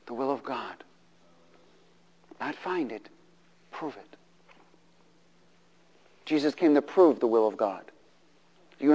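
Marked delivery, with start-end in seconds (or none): 0.1-4.2 much softer, almost breathed; quiet preaching hush; hushed emphasis. Acted. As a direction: drop the voice to draw them in. Preacher drops to near hush.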